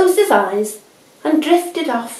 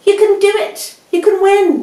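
high, louder excited shout; cheering shout; 13 semitones higher, 5 dB louder than usual. A narrator's reading. Animals at the finishing line cheering, high and loud. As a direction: urge him over the line. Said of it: "shouted the animals".

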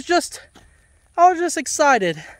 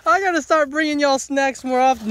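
ordinary talk

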